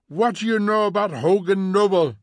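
loud, high angry demand; angry challenge. A narrator's reading. Montag challenges the women about politics, angry and loud. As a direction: throw the question out angrily.

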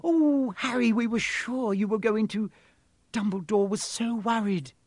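high-pitched, gushing relief; worried girl friend. A narrator's reading. Hermione tells Harry of their fears, high and worried. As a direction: fuss over the friend in a high voice.

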